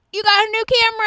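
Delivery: high, loud hammed-up cheer; mock excited praise. Friend praising co-host's new camera, mock excitement. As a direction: draw it out high like a teasing fanfare. Teasing compliment to co-host.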